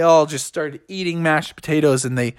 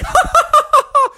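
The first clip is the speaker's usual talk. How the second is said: very high impish giggle; squeaky staccato villain laugh; mischievous cackle. A narrator's reading. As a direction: cackle like a naughty little bear.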